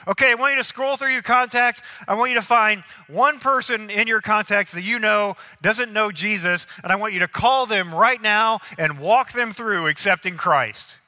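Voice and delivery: lightly acted emcee projection; host instructing crowd. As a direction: give the whole room its marching orders.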